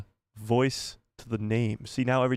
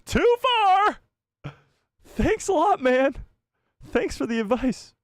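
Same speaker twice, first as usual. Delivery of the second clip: hammed-up high wail, words stretched into sobs; exaggerated tearful whine; Michael Scott crying; 4 dB louder, slower than usual. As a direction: blubber loudly in a very high mock cry. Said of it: "He starts crying. He starts going".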